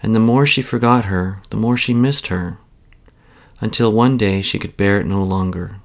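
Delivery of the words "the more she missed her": plain reading voice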